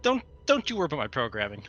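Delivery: ordinary talk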